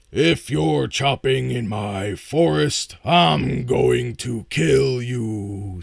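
loud, slow menacing troll. Acted. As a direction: threaten like a troll guarding its woods. Troll threatens the woodcutter.

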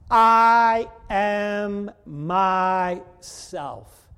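very slow, every word weighted; emphatic. Retold or imitated. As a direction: draw out I am myself with full weight. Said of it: "He couldn't have said it more emphatically".

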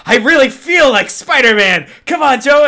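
imitating Little Joe's overexcited cheer; excited skit quote. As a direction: gush like a fan in the costume. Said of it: "he's like".

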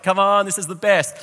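high-pitched retold excitement; mock giddy cheer; so excited. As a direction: re-enact a pumped-up driver egging things on. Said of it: "I was just so excited".

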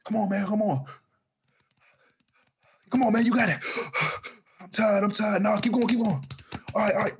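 voicing panicked runners. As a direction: voice the breathless men egging each other on. Voicing men running at night.

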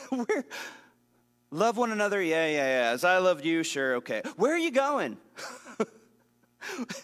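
hammed-up dismissive Peter; mocking dismissive Peter. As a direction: play Peter waving off the command. Mocking Peter ignoring Jesus.

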